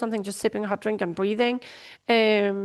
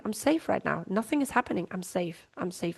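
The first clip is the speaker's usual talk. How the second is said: much softer, lower, settling into I'm safe; soft self-reassurance; 3 semitones lower, 7 dB quieter than usual. Shown as a demonstration. Showing listeners how to calm yourself, softly self-soothing. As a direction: say it quietly to yourself like a calming mantra. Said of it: "demonstrating self-reassurance".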